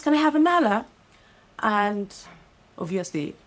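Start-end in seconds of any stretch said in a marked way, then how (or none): 0.0-0.9 high, loud mock of a customer forcing a British accent; mocking a forced accent. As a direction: imitate her putting on a posh order. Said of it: "She goes".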